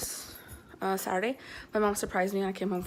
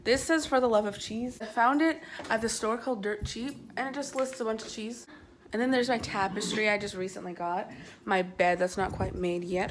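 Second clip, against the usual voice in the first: ordinary talk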